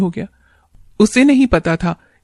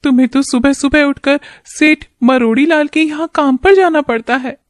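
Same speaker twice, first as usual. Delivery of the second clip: put-on higher, worried young wife; wife's voice; 7 semitones higher than usual. Wife worried about husband's early work, higher wife voice. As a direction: speak as a caring wife fretting over her husband.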